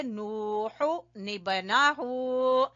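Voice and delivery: नूहु निबनाहु drawn out very slowly, with the n joined onto निबनाहु; slow Quranic recitation. Shown as a demonstration. Teacher demonstrating noon qutni to her class, slow recitation. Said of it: "तो हम क्या करेंगे?".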